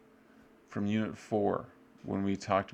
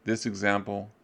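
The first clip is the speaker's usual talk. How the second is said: ordinary delivery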